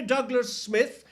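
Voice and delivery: normal talking voice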